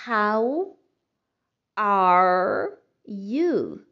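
how are you spoken slowly as a model; model English. Shown as a demonstration. Teacher models "How are you" for Portuguese learners. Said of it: "você vai dizer".

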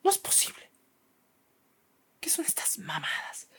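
unvoiced, scandalized teacher whisper; mock appalled whisper; hissed scolding whisper. Teacher scolding in front of mom.

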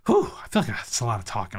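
breathless after talking a lot; puffing, out of breath. Real, not acted. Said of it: "I'm out of breath".

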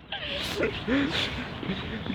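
high, quiet laughter; soft high-pitched giggling fit; real laugh. Real, not acted. Vlogger cracking up at the body joke, real laugh.

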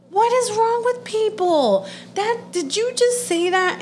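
high-pitched incredulous outburst; real exasperated disbelief. Real, not acted. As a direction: demand it in disbelief, pitch shooting up. Exasperated rant at relative's remark.

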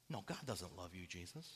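low, very soft verdict with pauses; hushed cruelty. Acted. Haters tell Jesus he is unloved, hushed and cruel. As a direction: drop right down and deliver it quietly.

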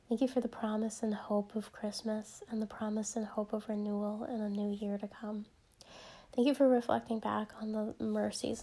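soft, hushed prayer voice, partly whispered; gentle closing prayer before the episode. Acted. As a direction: pray quietly and reverently, as if to God alone. Soft prayer opening the podcast.

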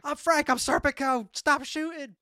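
high-pitched plea to hold fire; urgent calling out. Retold or imitated. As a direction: act out identifying yourself under fire. Serpico calls to police shooting at him.

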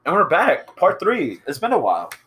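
high, loud, punchy return from a break; mock show host. Host welcoming listeners back for part three, loud announcer.

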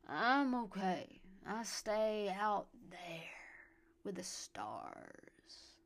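put-on very slow, drunken southern drawl; slurred southern drawl. A narrator's reading. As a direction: stretch it out, slurring, in a southern accent. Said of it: "the slurred southern drawl".

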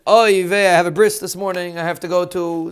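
whiny, put-upon complaint; kvetching. Retold or imitated. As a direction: groan it out like a complainer. Mocking a complainer about a bris.

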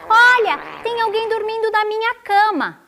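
high, squeaky cub alarm; little bear crying out; shouting baby bear. A narrator's reading. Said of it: "e gritou".

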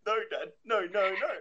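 high-pitched, hammed-up protest; mock pleading child. Kids refusing Dad's order to turn around, mock pleading. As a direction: plead like a frightened kid, playfully.

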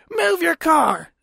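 high, drawn-out mock holler; mock yelling. Woman in car orders him to move, high drawn-out mock yell. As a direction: imitate her calling out, stretching each word high. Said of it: "she jumps in the car and she's like".

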